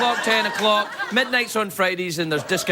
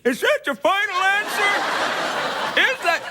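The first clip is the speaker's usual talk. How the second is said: much higher, very slow game show host voice; Regis impression; 12 semitones higher, slower than usual. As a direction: stretch every word out like a quiz host. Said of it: "I talk like this when I'm doing Regis".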